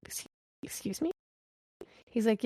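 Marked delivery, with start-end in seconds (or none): 0.1-1.1 high, breathy whispered disbelief; shocked. Retold or imitated. Mom hearing the doctor's plan, whispered higher disbelief. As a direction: gasp it out in disbelief, no voice. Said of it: "And I said".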